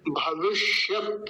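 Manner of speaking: भविष्यत slowly, holding the half ष and stopping on त; retroflex ष. Shown as a demonstration. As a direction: draw out the ष in भविष्यत, no vowel after त. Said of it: "मूर्धन्य शकार है". (भविष्यत में ष आधा, त हलंत)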